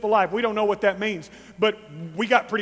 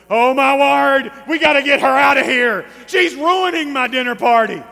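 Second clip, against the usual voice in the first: louder, hammed-up indignant host; outraged Simon; 4 semitones higher, 10 dB louder than usual. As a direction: fume like a scandalized dinner host. Simon's outrage voiced in sermon.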